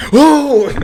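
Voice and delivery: sudden high, loud whoa; short high whoop. Retold or imitated. Rider telling of the Batman drop, high loud whoop. Acting out roller coaster drop.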